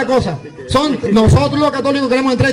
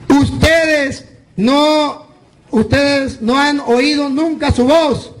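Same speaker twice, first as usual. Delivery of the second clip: slow emphatic; slower than usual. Acted. Preacher drives home scripture.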